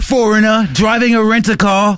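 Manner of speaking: high, exaggerated British tourist; mock British accent. Co-host playing the tourist in a rental car, mock British accent. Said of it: "Just like that".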